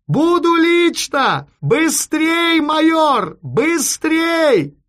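high, stretched, insistent yell. A narrator's reading. Chief hurrying the major by telephone, high drawn-out shout. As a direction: bellow urgent orders into the receiver. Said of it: "и закричал ещё громче".

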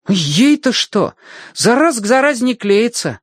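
put-on high-pitched boy arguing a point; reasoning boy. A narrator's reading. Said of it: "рассудил Тенька".

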